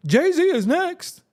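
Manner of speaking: high, slow, hammed-up gossip chant; mock the people who want him to fall. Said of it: "you got people saying".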